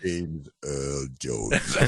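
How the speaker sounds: deep, slow announcer voice; booming and slow, news-anchor style; James Earl Jones impression. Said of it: "who talks like that".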